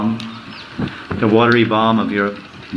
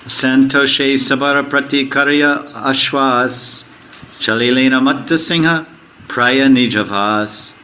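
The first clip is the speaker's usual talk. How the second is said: verse recitation; 2 semitones higher than usual. Acted. Chants the Bengali verse.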